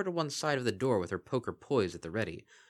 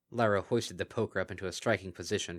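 usual speaking voice

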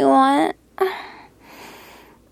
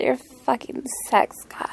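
whispering; 4 dB quieter than usual. Acted. Whispered praise.

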